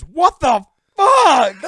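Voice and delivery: high, loud, slow outraged yell; shocked yell. Retold or imitated. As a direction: yell it in disbelief. John finds ketchup on hands.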